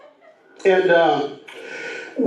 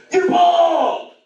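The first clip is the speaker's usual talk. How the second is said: high, drawn-out mock yell; mock top-of-the-lungs shriek of a small boy; imitated child's scream; 3 dB louder than usual. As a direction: imitate a kid blurting it across a room. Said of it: "he screams it again".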